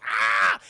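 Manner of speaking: short, loud mock scream; imitated creature scream. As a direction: cry out as the startled creature. Imitated creature scream when wetted.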